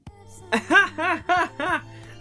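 high loud laugh. Real, not acted. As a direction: crack up laughing. Laughs at the punchline.

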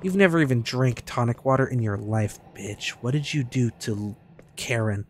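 ordinary talk

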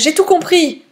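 proud cry of understanding; excited exclamation. A narrator's reading. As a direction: announce the discovery with glee. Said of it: "m'écriai-je".

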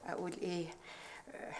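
whispered as if sharing a secret; secretive whisper. Acted. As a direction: drop to a whisper, conspiratorial. Pretends secrecy before telling.

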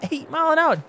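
incredulous. Real, not acted. Echoing unbelievable speed.

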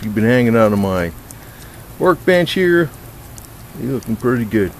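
ordinary talk, nothing marked

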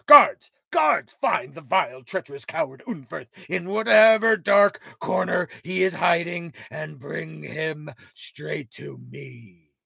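high, loud barked orders; commanding shout. Acted. King ordering guards to hunt the traitor, shouting loud. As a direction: call the guards across the hall.

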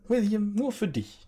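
high, coaxing hostess; sweet hostess voice. Acted. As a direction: hand it over sweetly. GM voices host offering cupcake.

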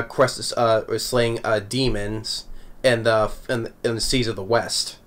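ordinary delivery

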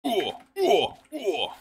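three high, short hurt grunts; imitated Steve grunts. Shown as a demonstration. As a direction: mimic game damage noises in a row. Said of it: "Pretty good, isn't it?".

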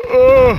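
big open yawn. Real, not acted. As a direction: yawn mid-sentence, tired. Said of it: "sorry about the yawns".

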